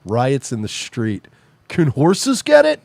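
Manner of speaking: mock urgent typing. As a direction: draw out a silly search query in mock panic. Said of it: "That's what you're typing into Google".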